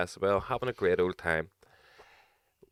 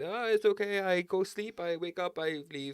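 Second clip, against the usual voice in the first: mock French accent; 10 semitones higher than usual. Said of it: "He was French".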